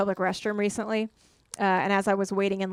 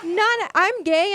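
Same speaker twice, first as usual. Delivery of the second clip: exasperated outburst; 10 semitones higher, 6 dB louder, much slower than usual. Real, not acted. Outraged comic reaction.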